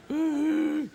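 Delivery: high foghorn-like hoot; a sustained awful "ooo"; bad clarinet noise. Comic showing his childhood playing, bad clarinet hoot. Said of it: "it was bad noise".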